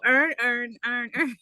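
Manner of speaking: put-on "Earn" for Aaron in Baltimore accent. Imitating how Baltimore says Aaron. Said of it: "Baltimore go".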